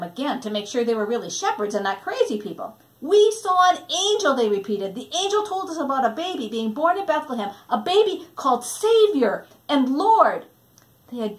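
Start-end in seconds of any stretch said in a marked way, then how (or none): 3.0-4.3 high, loud excited cry; excited shout. Acted. Shepherds exclaim, +10 st. 4.9-10.4 fast, high and loud telling; excited. Acted.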